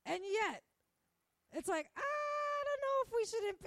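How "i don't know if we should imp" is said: very high, loud mock wail; exaggerated high-pitched waffling; mock dithering. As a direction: drag it out high like a hand-wringing ditherer. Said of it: "that's how they're acting".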